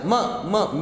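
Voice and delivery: "M" as a short muh, twice; bare m sound. Shown as a demonstration. Teacher drilling the first sound of matter, M as muh. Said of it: "this is not M".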